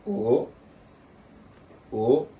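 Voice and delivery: O rising to high; second tone. Shown as a demonstration. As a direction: lift "O" upward, loud. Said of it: "On applique la même chose".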